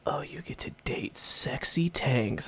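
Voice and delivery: breathy slow whisper of a teasing pitch; mock whisper. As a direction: hush it like a sly ad line. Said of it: "being like".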